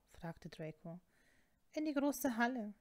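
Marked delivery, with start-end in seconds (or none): none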